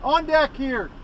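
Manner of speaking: sudden surprise, voice jumps up; surprised. Real, not acted. Chief surprised crew already there.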